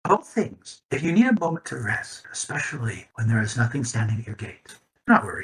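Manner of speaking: lightly voiced old lady with a hint of a snicker; a touch of a chuckle as the old lady waves it off; amused old lady. Old lady forgiving the captain, with a mild snicker. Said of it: "weird snicker she does in return".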